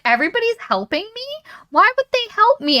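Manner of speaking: high-pitched incredulous questions. Retold or imitated. She reads that the village is helping her, incredulous. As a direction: voice her baffled disbelief, pitch way up. Voicing her disbelief at help.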